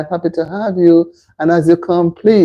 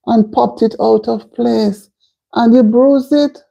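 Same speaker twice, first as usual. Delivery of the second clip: high, drawn-out sympathetic dismay; pained sympathy; 7 semitones higher than usual. Real, not acted. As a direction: commiserate in a high, concerned voice. Reacting to viewer's injury.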